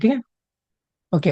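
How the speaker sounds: normal talking voice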